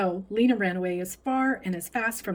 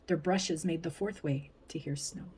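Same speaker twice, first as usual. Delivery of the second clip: usual speaking voice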